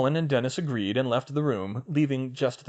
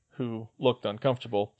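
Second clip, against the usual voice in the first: ordinary delivery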